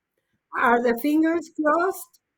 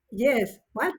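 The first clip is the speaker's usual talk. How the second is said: ordinary delivery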